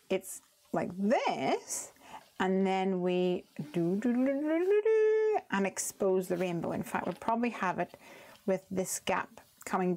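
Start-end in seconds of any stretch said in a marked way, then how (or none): 4.0-5.4 rising "do-do-do" effect. Shown as a demonstration. Crafter demonstrating how the card reveals a rainbow, rising sound effect. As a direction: run of "do"s climbing high and louder.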